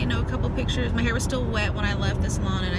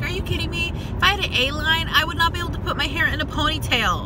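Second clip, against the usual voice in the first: high, fast outraged rant; indignant disbelief; 8 semitones higher than usual. Real, not acted. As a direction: let the outrage climb up high. Outraged at stylist's comment.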